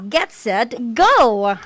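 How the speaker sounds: ordinary delivery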